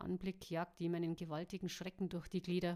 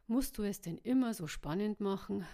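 louder, irritated grumble; grumbling; 6 dB louder than usual. A narrator's reading. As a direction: complain loudly with weary irritation. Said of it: "murrte der Täter".

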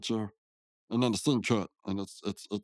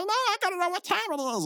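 much higher cartoon panic voice; squeaky panic. Rigby desperate to know the time, high and frantic. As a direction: plead in a squeaky sidekick voice.

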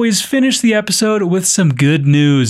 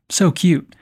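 ordinary delivery

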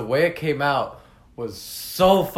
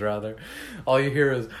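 ordinary talk, nothing marked

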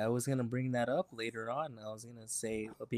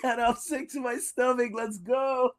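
higher, laughing pretend complaint; giggling, higher hammed-up protest; laughing mock outrage; 10 semitones higher, 7 dB louder than usual. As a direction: act disgusted while cracking up. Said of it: "I'd be like".

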